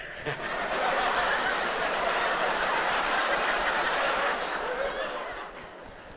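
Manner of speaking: long silent breathy giggle; real giggling fit; high wheezing laugh. Real, not acted. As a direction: break into a high wheezy cackle.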